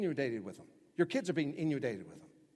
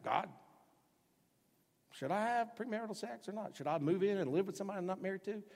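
mock prayer; 6 semitones higher than usual. Mocking a questioner's prayer.

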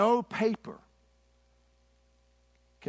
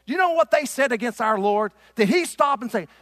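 loud, high pulpit challenge; preaching; 8 semitones higher, 10 dB louder than usual. Real, not acted. Preacher confronts congregation about their Lord, preaching loudly. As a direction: fire the questions out at the congregation.

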